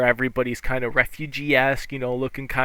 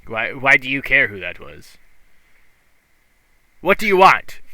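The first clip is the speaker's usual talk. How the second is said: irritated snap; 6 dB louder than usual. Acted. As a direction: bark the question at them. Fisherman snapping at player.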